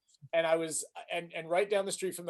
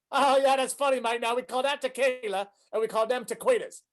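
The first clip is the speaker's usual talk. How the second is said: dry Aussie comeback, higher; Australian accent, wry and up; 5 semitones higher than usual. Retold or imitated. Australian waiter answers the joke, Australian accent. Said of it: "impressed by [name]'s Australian accent".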